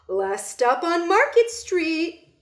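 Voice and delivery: loud, slow drawn-out announcement; calling out. Acted. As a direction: call the stop to the whole bus. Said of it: "Mister Dennis called".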